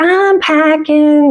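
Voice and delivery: put-on high, slow teenage protest; drawn-out teen whine; teenager. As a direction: drag it out like a put-upon teen.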